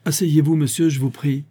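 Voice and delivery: cold formal order without outburst; father coldly telling his son to sit, sternly. A narrator's reading. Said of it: "d'une voix sévère mais sans éclat de colère".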